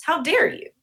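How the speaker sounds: how-dare-you outrage, put on; mock indignation. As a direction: act out a scandalized reproach. Acting out society's rebuke of her.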